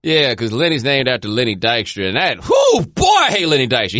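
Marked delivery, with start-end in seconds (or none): 2.4-4.0 raised, higher-pitched outburst; heated exclamation. Real, not acted. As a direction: burst out with emphatic dislike. Ranting about disliked player.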